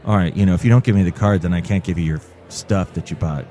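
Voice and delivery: low, quick imitation of rudeness; curt, rude cashier. Store worker refusing customer his purchases, rude. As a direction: talk down to the customer. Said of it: "even kinda actually rude to me".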